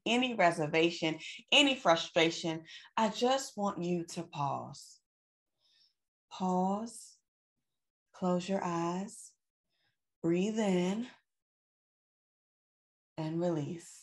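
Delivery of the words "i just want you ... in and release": soft, slow breathing guidance; calm guided breathing. Acted. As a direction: ease them into a slow breath. Pauses viewers to breathe.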